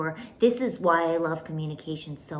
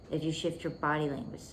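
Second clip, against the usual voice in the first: usual speaking voice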